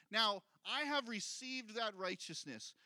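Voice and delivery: a firm stress on have. Shown as a demonstration. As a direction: have lifted and held. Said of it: "stressed have".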